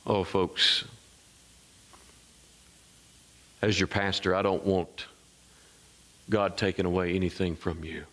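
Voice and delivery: low, earnest pastoral delivery; solemn appeal. Real, not acted. Pastor appealing to the folks he shepherds, solemn. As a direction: bring the pitch down and plead soberly.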